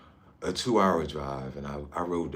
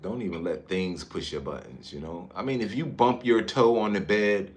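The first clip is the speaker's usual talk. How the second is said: ordinary talk, nothing marked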